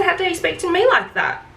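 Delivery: mock-outraged retort; indignant echo. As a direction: fire his words back at him, indignant.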